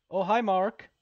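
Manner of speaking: loud, high exclaimed greeting; mock loud greeting. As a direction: call out a greeting in an exaggerated shout. Mock greeting after reading "The Room.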